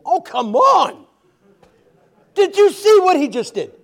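high, loud, incredulous disciple outburst; a disciple who can't believe his eyes, hollering; outraged disciple. Acted. Disciple yelling to the others as Jesus flies off, outraged.

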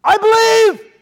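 high, loud cry of belief; a neighbour who believes too late, shouting it; desperate late convert. Acted. Left-behind neighbour crying out after the rapture, loud and desperate.

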